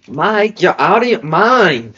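put-on high, loud, drawn-out Canadian accent; loud, slow put-on Canadian accent. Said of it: "that's a Canadian accent".

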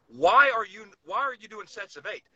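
usual speaking voice

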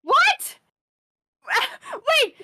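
high loud startled cry; shocked. Acted. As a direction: jump up in disbelief. Shocked in character.

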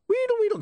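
very high wheedling gibberish; high squeaks dropping at the end; rewind squeal. Acted. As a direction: squeal like a tape winding back. Imp imitates rewinding noise.

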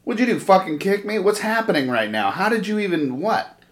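high, baffled questions; confused disbelief. Retold or imitated. Confused after being knocked down.

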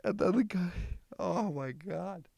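squeaky, high-pitched laughter through the words; can barely get the story out for laughing; real giggling fit while retelling the clip; laughing while talking. Real, not acted. Cracking up at the video.

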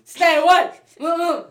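loud, high frightened warning; panicked refusal. Retold or imitated. Kid at the circus fending off balloon-blowing clowns, panicked yell. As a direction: yell it like clowns are closing in. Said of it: "I'd be like".

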